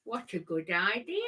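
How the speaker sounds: talking with a pen between teeth; words muffled round a pen; pen in mouth. Real, not acted. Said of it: "I have my pen in my mouth".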